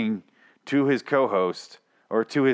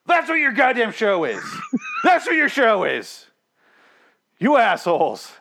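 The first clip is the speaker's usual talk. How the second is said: higher, louder exasperated rant; exasperated yell; 12 semitones higher, 4 dB louder than usual. Retold or imitated. Ranting at the podcast hosts, exasperated and loud. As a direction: yell at them in disbelief. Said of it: "I was like".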